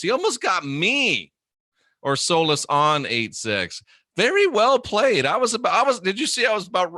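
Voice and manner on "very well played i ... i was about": excited praise. Real, not acted. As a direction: cheer the clever chatter, pitch jumping up. Admiring a chatter who almost fooled him.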